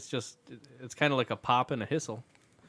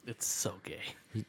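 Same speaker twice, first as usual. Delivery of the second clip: slow, soft whisper; 5 semitones lower, 12 dB quieter, much slower than usual. Acted. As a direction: whisper the joke slowly. Punning on the product name.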